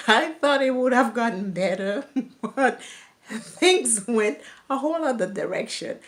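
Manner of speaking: real laughter breaking into the line; laughing. Real, not acted. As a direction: chuckle through the words, rueful. Laughs at how things turned.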